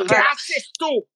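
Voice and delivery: slow, loud, high mock German accent. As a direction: ham up a German asking what you do. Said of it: "Con su acento ahí medio alemán".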